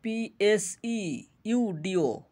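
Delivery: letters spelt slowly one by one, P S E U D O; slow letter-by-letter spelling. Shown as a demonstration. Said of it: "এখানে পির উচ্চারণ হবে না".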